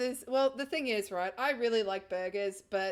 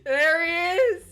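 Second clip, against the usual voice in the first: loud, raised squee of recognition; higher, excited cheer; delighted fangirl greeting; 9 semitones higher, 11 dB louder than usual. Real, not acted. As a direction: greet your favourite character with a loud thrill. Favourite character appears on screen.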